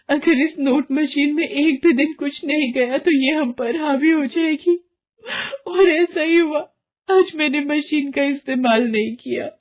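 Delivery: high, tearful sobbing speech; crying mother. Acted. Varsha crying to her husband after losing her son. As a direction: weep over the lost child.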